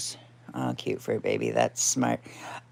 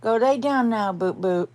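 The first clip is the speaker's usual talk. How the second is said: high coaxing voice to the cat; pet talk; 7 semitones higher than usual. Real, not acted. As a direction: coax the cat to bed up high. Telling cat to lie down.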